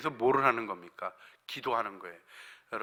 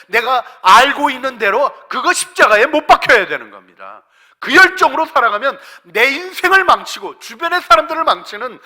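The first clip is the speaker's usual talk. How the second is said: loud, raised preacher's voice; impassioned preaching; 10 semitones higher, 13 dB louder than usual. Real, not acted. Preacher warning congregation about wayward passion, full-force preaching. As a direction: drive the warning home to the congregation.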